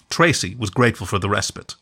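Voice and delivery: usual speaking voice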